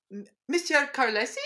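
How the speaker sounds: put-on high, quick, unconvincing French accent; hammed-up fake French accent; badly faked French accent. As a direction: attempt a French teacher and miss. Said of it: "like, not a French accent".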